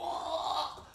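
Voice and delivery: high, drawn-out mock cry of being stabbed; stretched high-pitched wail, imitated; imitated victim cry.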